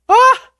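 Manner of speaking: short, very high, loud shriek; excited yelp. Real, not acted. Excited yelp at moving cheese.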